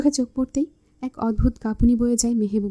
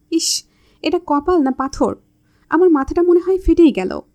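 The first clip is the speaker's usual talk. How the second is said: higher, louder pained complaint; startled ouch; 5 semitones higher, 5 dB louder than usual. A narrator's reading. Mehbuba hurts her hand on Labib's forehead, startled. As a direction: yelp as if your head hit stone. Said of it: "Mehbooba চমকে উঠে বলে".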